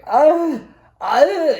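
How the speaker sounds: high, loud hammed-up fake wail; mock sobbing wail. Guy fake-crying for the beach photographer, hammed-up wail. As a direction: wail like a bad actor crying.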